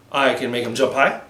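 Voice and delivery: ordinary delivery, nothing marked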